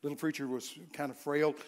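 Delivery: ordinary delivery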